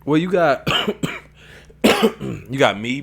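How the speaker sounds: normal talking voice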